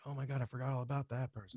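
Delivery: soft, quick "oh my God"; hushed surprise. Retold or imitated. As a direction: marvel to yourself quietly. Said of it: "I'm like".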